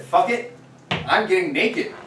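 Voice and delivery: bold declaration. Retold or imitated. Storyteller quoting himself at sixteen to the audience, bold declaration. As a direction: throw it out as a defiant declaration. Retells bold teenage decision to streak.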